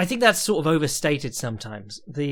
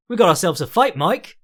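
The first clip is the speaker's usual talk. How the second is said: loud, rising announcer call, imitated; Big John catchphrase; 5 semitones higher, 8 dB louder than usual. Imitating Big John calling the fight, loud and rising. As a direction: ham up a commentator hyping the fight. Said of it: "all in the intonation".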